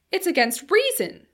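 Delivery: high, loud protest; emphatic objection. A narrator's reading. Philby arguing with the time traveler, protesting loudly. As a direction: object forcefully, pitch jumping up.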